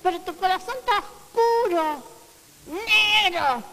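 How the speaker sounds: slow, loud rebuke; voz de reproche. Retold or imitated. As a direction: scold them as hypocrites. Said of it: "Le decía". (reproche lento y fuerte)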